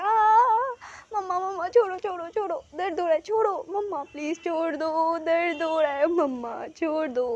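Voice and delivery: high, loud cries of pain; pained crying plea. A narrator's reading. Son cries out as mother twists his ear, pained and loud. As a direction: beg her to let go of the ear, hurting. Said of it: "wing कहते हैं".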